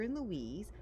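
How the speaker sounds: usual speaking voice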